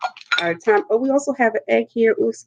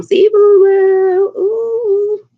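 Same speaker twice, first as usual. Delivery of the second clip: excited; 13 semitones higher, 7 dB louder, much slower than usual. Real, not acted. Delight at the seafood.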